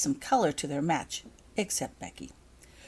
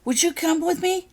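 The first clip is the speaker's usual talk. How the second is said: panicked plea; 11 semitones higher, 6 dB louder than usual. A narrator's reading. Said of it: "Becky turned to Holly in a panic".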